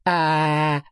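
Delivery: long, level "aah" for a throat check; drawn-out, unwavering "aah"; saying "ah". A narrator's reading. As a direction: hold an open-mouthed "aah". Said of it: "скажи «а»".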